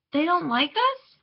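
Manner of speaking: put-on high, slow child's question, rising; little sister asking. A narrator's reading. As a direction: ask it as a puzzled little girl. Little sister questions her father.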